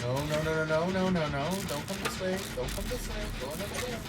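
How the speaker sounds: nervous whispered warning; anxious plea. Real, not acted. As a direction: hush them away from danger. Warning teammate away, tense.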